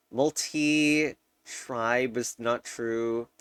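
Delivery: ordinary talk, nothing marked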